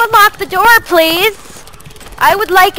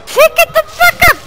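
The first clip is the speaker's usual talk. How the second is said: frustrated shout at the screen; angry shout; 10 semitones higher than usual. Real, not acted. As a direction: scream the order at them. Yelling at game character.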